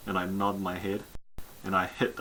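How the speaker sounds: ordinary talk, nothing marked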